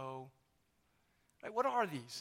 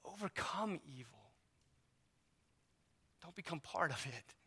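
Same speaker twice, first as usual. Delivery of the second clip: quiet scripture quote; 3 semitones lower, 11 dB quieter than usual. Retold or imitated. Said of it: "God says".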